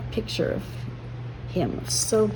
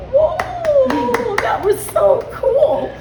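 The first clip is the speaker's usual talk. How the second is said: amazed onlookers; 7 dB louder, slower than usual. Retold or imitated. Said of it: "they were like".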